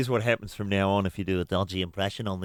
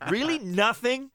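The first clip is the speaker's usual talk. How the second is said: much higher incredulous questions; mock amazement; 13 semitones higher, slower than usual. Real, not acted. Host reacting to the producer's Google search, high and amazed. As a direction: squeak out amazed disbelief.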